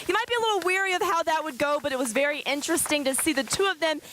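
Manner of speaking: reporter voice-over, brisk and bright; news field reporter. Acted.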